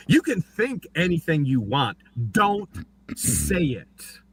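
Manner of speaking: high, mock-stern warning from the bench; magistrate's warning. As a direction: scold him like a stern judge, lightly played. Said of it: "he said".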